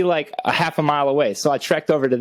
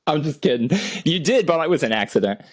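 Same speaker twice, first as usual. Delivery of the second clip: usual speaking voice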